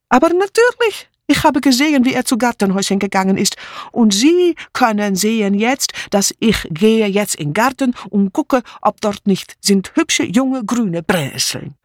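put-on high, excited voice in accented German; agitated refugee maid, pitch jumping up; emphatic foreign maid. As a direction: insist as if it were obvious. Mitzi insists emphatically.

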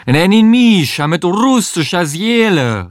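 high, hammed-up Russian accent called out. A narrator's reading. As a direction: call it out in your best theatrical Russian accent. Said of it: "rief sie, so russisch sie konnte". (übertriebener russischer Akzent, laut gerufen)

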